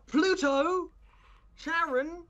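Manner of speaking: high excited calls; exclamations. Acted. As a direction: cry out the names. Character exclaims names.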